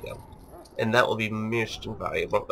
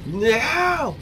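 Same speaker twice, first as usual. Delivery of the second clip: mock outcry; 12 semitones higher, 5 dB louder than usual. Mock protest at attacking Duncan.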